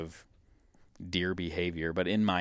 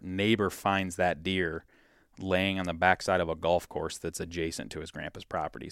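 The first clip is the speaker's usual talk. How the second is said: normal talking voice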